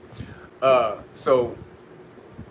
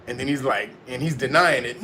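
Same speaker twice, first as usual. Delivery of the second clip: ordinary delivery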